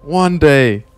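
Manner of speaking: hammed-up pep talk to a struggling gamer; mock motivational speech. Streamer parodying a motivation video, loud preachy. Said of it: "Is this motivation video?".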